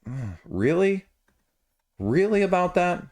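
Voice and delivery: incredulous "really?"; skeptical reaction. Acted. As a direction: question it in mild disbelief. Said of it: "I just look at that and I go".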